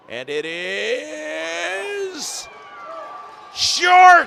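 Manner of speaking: long rising stretch, then shouted "short"; excited call. Real, not acted. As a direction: hold the suspense, then explode. Measurement result call.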